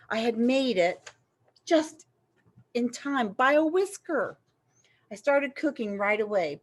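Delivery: ordinary delivery, nothing marked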